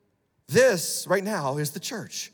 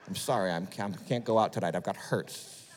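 mock self-pity; 9 semitones lower, 7 dB quieter than usual.

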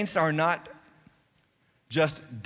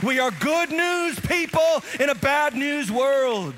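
high, loud, driving sermon voice; preaching at full force; 6 semitones higher, 4 dB louder than usual. Acted. Pastor urging the congregation to give, preaching at full force. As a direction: preach it hard to the whole room.

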